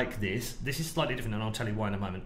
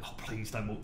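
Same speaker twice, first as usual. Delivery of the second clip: softer plea; 2 semitones lower, 7 dB quieter than usual. Retold or imitated. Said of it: "And I'm like".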